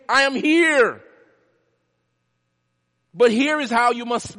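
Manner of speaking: high, loud, slow plea to be recognised; Jesus calls his people to see him, loud; Jesus pleading. Acted. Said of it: "He said".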